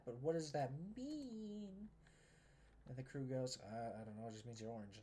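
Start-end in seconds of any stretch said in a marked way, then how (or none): 0.0-1.9 drawn-out, higher mock bewilderment; exasperated retelling. Engine questions his crew about being orange, mock exasperated. 3.6-5.0 lightly voiced low, soft mumbly crew; clueless crew. Crew answers the confused engine, lightly voiced and clueless.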